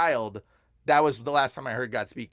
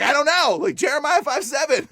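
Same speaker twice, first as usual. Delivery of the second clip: high, loud, flustered answer; re-enacted panicky reply; retold flustered panic; 10 semitones higher, 6 dB louder than usual. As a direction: blurt it out, at a loss.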